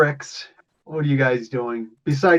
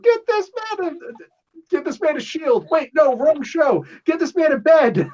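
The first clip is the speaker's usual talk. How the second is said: much higher, frantic mock announcement; high-pitched comic order to staff; hammed-up urgent call; 12 semitones higher than usual. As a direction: call it out high like a show character. Hammed-up call for medical help.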